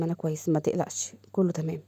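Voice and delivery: quiet reassurance. Acted. Father to his worried son Hazem, quietly reassuring. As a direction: play down the worry, softly.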